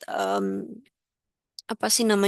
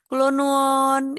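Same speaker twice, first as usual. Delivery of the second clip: slow, soft Central Javanese greeting, a little higher; soft Javanese speech; 4 semitones higher, 3 dB louder, slower than usual. Retold or imitated. Teacher imitating how Central Javanese people speak, softly and slowly. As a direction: imitate a polite Javanese visitor speaking small and gently. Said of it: "ini ngomongnya kecil-kecil".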